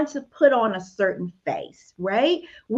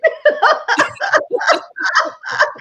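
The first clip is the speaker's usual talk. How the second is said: airy high laugh, almost a squeal; breathy high laugh; 5 dB louder than usual. Real, not acted. Laughing at her own bold prayer, breathy high laugh.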